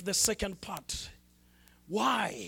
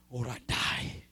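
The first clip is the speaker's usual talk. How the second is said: breathless whispered ending; whispered plea; 7 semitones lower, 5 dB quieter than usual. Retold or imitated. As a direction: drop to a whisper of desperation. Preacher retells a desperate prayer.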